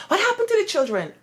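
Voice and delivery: imitated shout. Headmistress demanding answers about unruly children, imitated shout. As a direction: fire the question at her like an angry boss. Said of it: "Miss Hamer started shouting".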